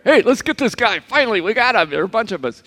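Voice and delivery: high, loud gleeful mob shout; a crowd finally catching its target; angry mob. Retold or imitated. Angry mob grabbing tax collector.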